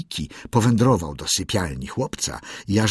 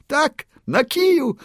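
much higher, louder "kiju" falling; shrill gleeful bird; 4 dB louder than usual. A narrator's reading. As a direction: crow "tak, na kiju" in triumph. Bird repeats phrase higher.

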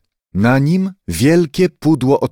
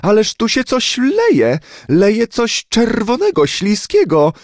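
much higher, shrill-edged cry of disgust and fear; alarmed exclamation; 10 semitones higher than usual. A narrator's reading. Startled lady jumping onto a stranger's lap, crying out in alarm. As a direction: call out in horror at the leak. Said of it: "zawołała".

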